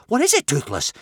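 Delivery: high, loud startled question; alarmed gasp. A narrator's reading. Boy asking his shrieking dragon, gasping in alarm. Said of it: "gasped Hiccup".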